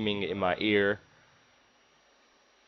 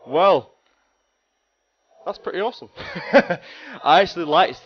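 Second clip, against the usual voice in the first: laughing; 8 semitones higher, 8 dB louder than usual. Real, not acted. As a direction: laugh through the words. Laughs after the chase.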